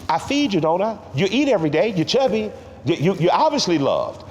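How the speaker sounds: imitating grandma's high indignant comeback; grandmother's retort. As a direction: mimic her snapping back at a silly question. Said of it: "her turning around and said".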